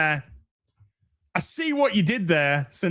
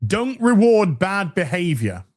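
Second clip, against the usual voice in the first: ordinary talk, nothing marked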